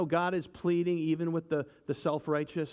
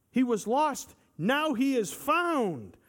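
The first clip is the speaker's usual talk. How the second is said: much higher, louder, joyful proclamation; fervent preaching; 8 semitones higher, 3 dB louder, slower than usual. Acted. Preacher proclaiming the father's joy to the church, fervent.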